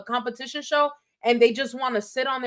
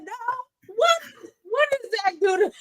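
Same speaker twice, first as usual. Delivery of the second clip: much higher, half-crying outcry; mock shocked cry; 11 semitones higher than usual. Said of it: "And I said".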